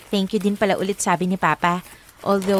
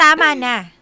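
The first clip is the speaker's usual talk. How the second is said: annoyed; 3 semitones higher, 6 dB louder than usual. Acted. Annoyed, stops friends' teasing.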